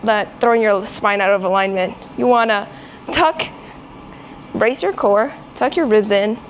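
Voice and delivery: loud, strained under the weight; lifting weights. Real, not acted. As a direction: push the words out while lifting. Talking mid-set while straining.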